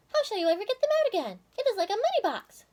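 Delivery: quick, high, wondering squirrel voice; Goody. A narrator's reading. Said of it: "said Goody".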